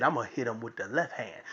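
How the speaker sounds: low, soft, quick delivery; understated announcement. Retold or imitated. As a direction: say it low and fast, almost under the breath. Father's calm setup, against daughter's reply.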